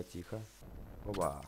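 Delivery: ordinary talk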